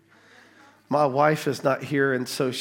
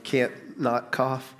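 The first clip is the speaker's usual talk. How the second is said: slow mock helpless; 4 dB quieter, much slower than usual. Real, not acted. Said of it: "you're just like".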